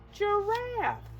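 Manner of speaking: ordinary talk, nothing marked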